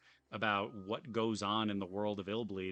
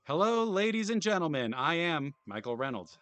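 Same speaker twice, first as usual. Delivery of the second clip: higher, louder grand greeting; showman host voice; 8 semitones higher, 8 dB louder than usual. Acted. Streamer voicing the park owner's welcome, hammy showman. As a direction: roll out the greeting like a ringmaster. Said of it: "who I'm guessing is Michael Reynolds".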